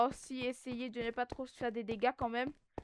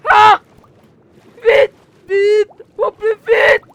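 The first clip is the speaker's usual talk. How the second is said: high, loud frantic urging; urgent panic; 9 semitones higher, 23 dB louder, much slower than usual. Real, not acted. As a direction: shout it in a hurry. Panic escaping danger.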